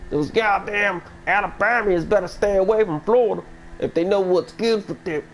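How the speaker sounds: high-pitched, hammed-up Southern warning; exaggerated Southern impression; mock Southern accent. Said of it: "my mocking of a Southern pr-, impression".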